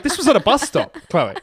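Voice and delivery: mocking retort. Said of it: "It's like".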